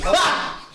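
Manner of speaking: a sudden high yelp of dismay; dismayed cry. Real, not acted. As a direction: yelp at the bad roll. Rolls critical fail.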